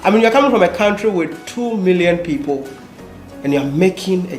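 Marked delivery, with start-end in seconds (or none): none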